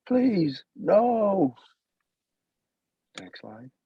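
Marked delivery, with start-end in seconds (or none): 0.1-1.6 high, desperate plea; pleading. Acted. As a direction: beg in high panic. Mother begs for her baby.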